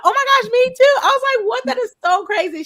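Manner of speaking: high, loud excited squeal. Retold or imitated. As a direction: shriek with delighted surprise. Both from North Hardin.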